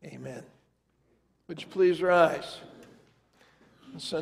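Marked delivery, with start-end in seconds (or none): none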